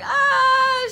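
high teary "ah"; mock whimper. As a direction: let out a teary whine. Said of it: "And I'm like".

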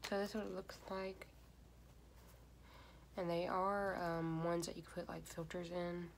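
sheet mask on face; talking through sheet mask. Real, not acted. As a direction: lips held by wet mask. Seen 4: red sheet mask covers whole face, mouth opening, throughout.

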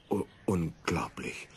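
softly stammered astonishment; stammering. Acted. Wife stammers in shock at the flying jacket, softly. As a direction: stutter out your amazement. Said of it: "stammelte Frau Hansen".

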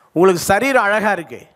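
normal talking voice

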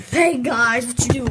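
loud call to the group; loud greeting. Acted. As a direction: burst in calling out to everyone. Newcomer calls out to the group.

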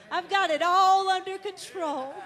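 rising preaching emphasis on all. Shown as a demonstration.

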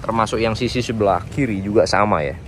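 normal talking voice

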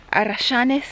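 ARRACHANES in Argentine Spanish, with a "sh" sound; Argentine accent. Shown as a demonstration. Naming the trees for viewers, Argentine accent on "arrachanes". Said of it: "going for the Argentine accent".

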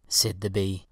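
usual speaking voice

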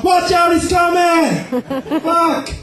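panicked high shout. Pretend. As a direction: yell a warning in panic. Bystanders yelling at stair run.

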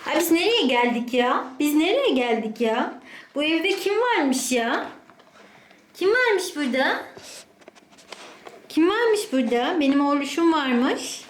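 mother cooing at her dog. Real, not acted. Greeting the dog in baby talk.

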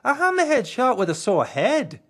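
high, loud indignant correction; old shark indignantly corrects Green; correcting old shark. A narrator's reading. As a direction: bristle as you set him straight. Shark complains.

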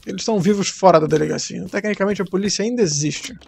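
normal talking voice

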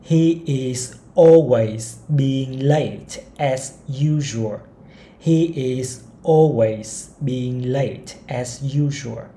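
each word of the sentence spaced out slowly; slow model reading. Shown as a demonstration. Teacher reading a model sentence to learners, very slowly. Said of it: "cái tiếp theo này".

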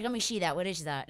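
wired-jaw imitation with mushy s sounds; unable to talk. Retelling the question to dad, jokingly talking through a wired jaw. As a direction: replay it through shut teeth, amused. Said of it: "I couldn't talk".

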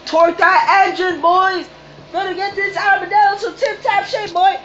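very high, squeaky put-on voice; silly high-pitched pep talk; hammy mechanic voice. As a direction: order the crew around in falsetto. Hammy mechanic shouting orders in game.

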